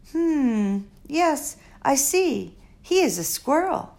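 put-on a touch of a curious elderly doctor; Doctor Measham. Old doctor peering at the squirrel, lightly voiced.

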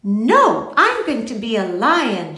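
loud, emphatic little announcement; lightly voiced proud little girl; mildly proud. A narrator's reading. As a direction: declare it with a touch of pride. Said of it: "she said proudly".

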